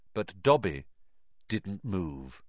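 usual speaking voice

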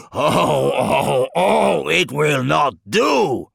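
high, loud wail of disgust; loud, anguished demon complaint; outraged outburst. A narrator's reading. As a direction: wail it out, dragging the last words. Disgusted demon's outburst in narrated story.

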